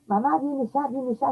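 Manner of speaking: high, urgent imitation of a sobbing girl; mild imitation of a teen crying for her mom; crying daughter's plea. Mother retelling her daughter's tearful call at dawn.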